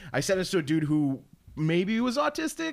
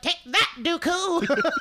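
imitating the fan; 11 semitones higher, much slower than usual. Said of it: "he's like".

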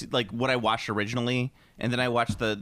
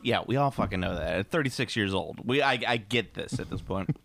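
ordinary talk

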